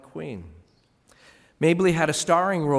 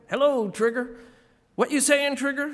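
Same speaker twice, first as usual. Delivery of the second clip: high, raised voice calling Trigger; mock greeting; 12 semitones higher, 4 dB louder than usual. Townsfolk in the South greeting her, high and loud. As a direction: sing out the nickname like a passerby.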